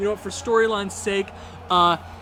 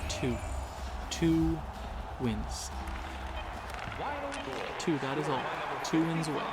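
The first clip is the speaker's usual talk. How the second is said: low, soft history-recap voice; hushed, wistful looking back; reflective documentary narration; 6 semitones lower, 6 dB quieter than usual. Acted. Retells the franchise history.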